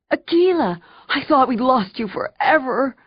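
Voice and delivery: high, excited little dog voice; overjoyed dog. Acted. Total overjoyed dog is alive.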